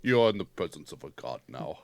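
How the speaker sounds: god-king voice. Pretend.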